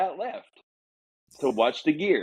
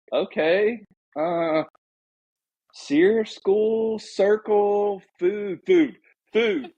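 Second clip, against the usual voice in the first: raised, loud broken Spanish, every word pushed out; playing a lost American grasping for Spanish words; 10 semitones higher, 4 dB louder, much slower than usual. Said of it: "In broken Spanish".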